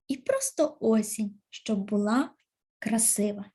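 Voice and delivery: expressive recitation of a poem; poem reading. Acted. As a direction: read it like a poem for children. Teacher reads poem aloud. (виразне читання вірша)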